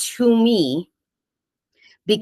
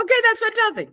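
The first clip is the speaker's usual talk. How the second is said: much higher, gushing delight; excited; much faster than usual. Real, not acted. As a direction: beam it out with real joy. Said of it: "I was, like, so shocked".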